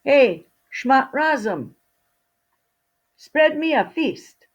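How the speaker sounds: loud, high call into the empty room. Acted. Fido calls out to the invisible servant, loud and high. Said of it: "called out".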